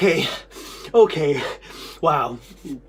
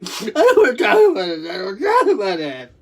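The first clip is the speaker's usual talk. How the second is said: real sobs mixed with laughter, higher pitched; embarrassed crying; 3 semitones higher than usual. Real, not acted. Speaker refusing to talk about an embarrassing memory, embarrassed crying. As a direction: wail it out between coughs and laughs. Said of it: "I'm embarrassed".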